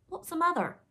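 high, loud, puzzled pirate voice; ignorant question. A narrator's reading. Ignorant pirate asks his mates, high puzzled voice. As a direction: ask it high and cluelessly. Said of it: "asked the ignorant Smee".

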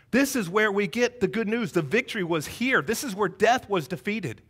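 ordinary delivery

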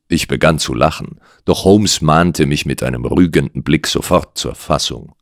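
usual speaking voice

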